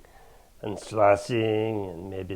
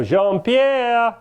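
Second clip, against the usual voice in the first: drawn-out, high singsong call; 11 semitones higher, 4 dB louder than usual. Acted. Chef searching for his sous chef, calling out. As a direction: call slowly for someone out of sight.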